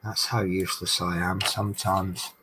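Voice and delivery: usual speaking voice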